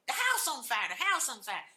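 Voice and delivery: loud urgent repeated warning; alarmed shout. Retold or imitated. Father warning the family of the fire, loud shout.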